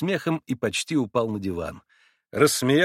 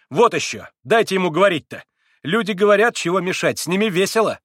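higher, louder irritated snap; snapping; 8 semitones higher, 7 dB louder than usual. A narrator's reading. Grushenka snaps at the Pole, irritated. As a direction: snap back at him. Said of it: "огрызнулась Грушенька".

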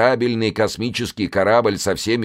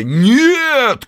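high, loud shouted no; 12 semitones higher, 6 dB louder than usual. Acted. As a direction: shout it as a chorus. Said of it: "крикнули крестоносцы любви".

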